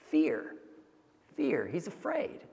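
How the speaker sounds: softly, naming fear; soft, solemn. Real, not acted. Preacher to congregation, quietly naming fear. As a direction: let it sink in quietly. Preacher names the root of anger.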